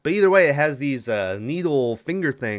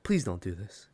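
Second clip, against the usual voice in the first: quiet shocked plea; 4 semitones lower, 8 dB quieter than usual. Retold or imitated. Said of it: "in shock and calmed down".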